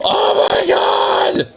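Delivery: shrieking gasp then a slow, high squealing oh my god; a high, stretched-out squeal of delight. Real, not acted. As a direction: gasp and squeal at something unbearably cute. Squealing at cute kitten pictures.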